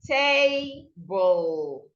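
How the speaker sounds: "table" with a long TA and a dropping ble; stress first syllable. Shown as a demonstration. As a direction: lean on the first syllable of "table", let the second fall. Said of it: "Then this part drops".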